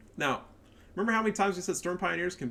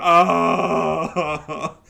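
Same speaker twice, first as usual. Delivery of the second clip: mock cry; 3 semitones higher, 10 dB louder than usual. Mocking pro players crying.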